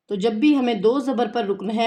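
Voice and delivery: ordinary talk, nothing marked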